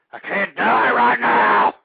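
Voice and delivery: desperate shout. Acted. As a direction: yell it out like you're dying. Panicked host yells into mic.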